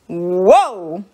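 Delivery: long, drawn-out whoa; mildly awed at how much it is; impressed. Acted. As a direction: stretch it out in slow amazement. Gloria reacts to huge page count.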